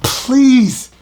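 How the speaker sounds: usual speaking voice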